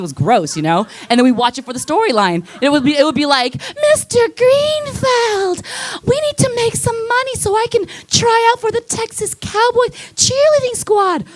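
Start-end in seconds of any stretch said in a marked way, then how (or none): 3.9-11.2 breathy porn actress. Retold or imitated. Comic telling a porn scene, voicing the actress in high ditzy tone. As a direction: play the naive starlet, pitched way up.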